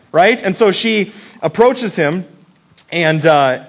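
ordinary delivery

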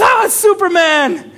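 mock excited bystander. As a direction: point at the sky in mock awe. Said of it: "somebody says".

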